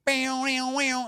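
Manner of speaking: high wailing siren noise; imitated alarm. Ring alarm meant to scare him off, imitated high siren. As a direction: mimic an alarm in swooping high tones. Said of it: "looking at the thing, and they're going".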